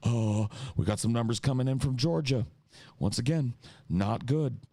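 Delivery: mock newscaster losing composure, repeating himself; frantic caricature of a host reading bad numbers; imitating Cenk Uygur. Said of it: "and he's like".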